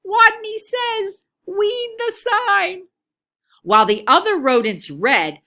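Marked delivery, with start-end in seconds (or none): 0.1-2.9 high, slow squeak with w for r; tiny timid rodent squeaking out the command; squeaking leader of the game; squeaky Wadney. A narrator's reading. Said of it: "and squeaked".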